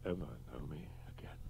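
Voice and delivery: soft, faint whispered question; quiet grief. Acted. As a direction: whisper it, close to tears. Grieving lover, quiet question.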